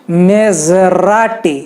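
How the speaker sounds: "Maserati" very slowly, with a zh sound; Italian Maserati. Shown as a demonstration. Teacher showing the Italian name, slow. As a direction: stretch "Maserati" with zh. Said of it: "झ होता है".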